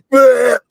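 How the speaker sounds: short squealing laugh; high laugh. Real, not acted. Laughs retelling gross moment.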